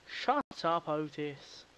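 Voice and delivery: usual speaking voice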